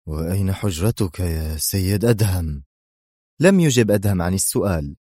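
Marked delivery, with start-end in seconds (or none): none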